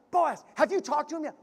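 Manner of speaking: eager, pressing Boaz for news; worked up. Retold or imitated. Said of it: "Ruth could have got herself all worked up".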